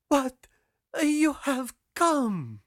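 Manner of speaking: high, loud, drawn-out disbelief; astonished girl. A narrator's reading. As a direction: stretch it out high in amazement. Girl astonished at sailor's return.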